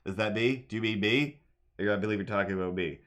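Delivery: ordinary delivery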